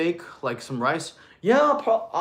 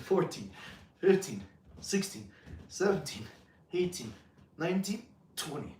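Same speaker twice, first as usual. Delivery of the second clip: quiet, high strained rep count; real effort in the voice, softly counted; push-up counting; 3 semitones higher, 8 dB quieter than usual. Real, not acted. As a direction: count each rep under exertion, in short bursts. Counting reps while doing push-ups.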